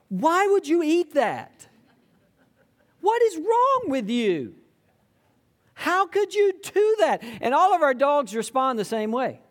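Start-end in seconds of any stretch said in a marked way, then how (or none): 0.1-7.2 high, louder exasperated scolding of a dog. Pretend. Pastor scolding his dog for eating vomit, high exasperated scold. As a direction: tell off a dog that ate something gross.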